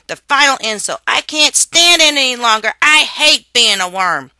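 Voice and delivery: put-on loud, high, furious child's rant; angry outburst. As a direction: rage at the unfairness of worm life. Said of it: "exhausted by his outburst".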